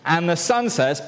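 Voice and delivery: usual speaking voice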